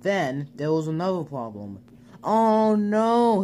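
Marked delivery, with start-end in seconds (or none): 2.2-3.4 alarmed cry, higher and louder; a sudden frightened "oh no"; cried out. Acted. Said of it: "he cried".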